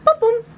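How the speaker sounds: high vocal boom-boom; imitated drum hit. Host revealing the favorite book to viewers, imitated drum hit. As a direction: punch out a high drum flourish.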